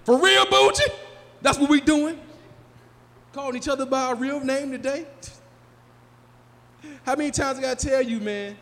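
high, loud mock indignation; mad friend. Friend objecting to his real name, mad. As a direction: protest loudly, as if deeply offended. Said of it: "he'll get mad you call him anything else".

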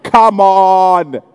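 loud, badly faked British accent; a hint of hammed-up British; mock British accent. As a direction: put on a clumsy British voice. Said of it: "there's a British accent involved".

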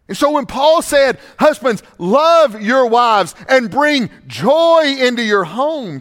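high, loud, fervent preaching cadence; full-force preaching. Acted. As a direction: drive the point home to the husbands. Preaching again.

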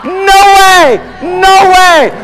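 high, loud preacher's refusal; defiant shout. Real, not acted. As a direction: shout it down at full force. Defying the devil at sermon's climax.

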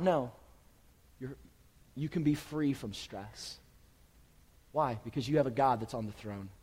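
normal talking voice

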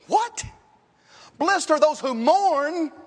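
high-pitched, disbelieving echo of scripture; incredulous. Acted. Preacher questioning Jesus' paradox before the congregation, incredulous. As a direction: question it in rising amazement, drawing the words out.

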